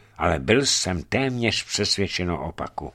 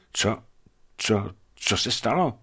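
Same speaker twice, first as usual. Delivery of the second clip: slow, dazed; 3 semitones higher than usual. A narrator's reading. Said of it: "pronesl pomalu".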